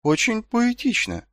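high, slow, tentative remark; cautious. A narrator's reading. Marko comments on Nobbs's chanted verse, cautiously. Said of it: "осторожно заметил Маркоу".

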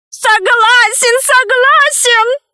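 very high, drawn-out squeal of surrender; crying out. A narrator's reading. Pygmy hastily gives in to his judges, crying out. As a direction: squeal your agreement, stretching each word. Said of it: "закричал пигмей".

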